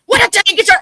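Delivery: high, loud scolding burst; sharp rise into a mock telling-off; mama's holler. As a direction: imitate a mother hollering at her boy. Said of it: "then she hollered at him".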